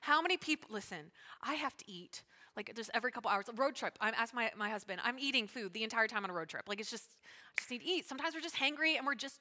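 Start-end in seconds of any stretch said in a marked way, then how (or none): none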